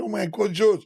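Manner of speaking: lightly mock fan cry; imitating shouting fans. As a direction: mimic women calling out to him. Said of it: "Women, they were shouting".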